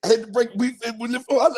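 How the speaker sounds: ordinary talk, nothing marked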